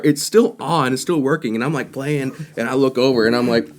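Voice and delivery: normal talking voice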